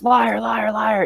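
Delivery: high ghostly yell in his face; furious ghost yelling liar. Acted. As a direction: scream it right into his face. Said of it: "and yells".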